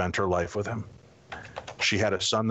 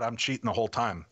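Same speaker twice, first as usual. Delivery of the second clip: ordinary delivery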